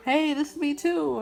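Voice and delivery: high, loud cheerful greeting; excited. Retold or imitated. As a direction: greet brightly. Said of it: "He's like".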